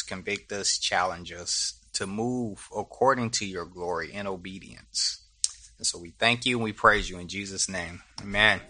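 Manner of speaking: measured, reverent prayer voice; opening prayer. Real, not acted. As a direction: lead a congregation in calm prayer.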